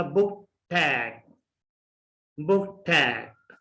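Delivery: बुक टैट with a breathy th, slowly; बुक टैट said as book-thet; बुक टैट with an aspirated t; aspirated t link. Shown as a demonstration. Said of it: "ठ में change होगा".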